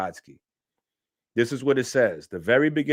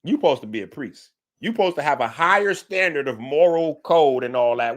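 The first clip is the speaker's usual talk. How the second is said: normal talking voice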